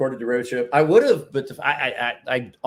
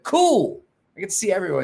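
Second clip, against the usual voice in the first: high, loud cool that falls; a burst of delight at seeing everyone; excited cool; 7 semitones higher, 5 dB louder than usual. Retold or imitated. Answering Nicholas in retold chat.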